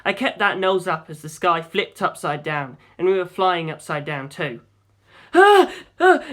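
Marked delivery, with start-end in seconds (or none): 5.3-6.2 loud high panicked yelps; panicked yells. A narrator's reading. Navigator yelling as the plane flips upside down, panicked. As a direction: yelp as the plane flips over. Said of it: "Jim yelled behind me".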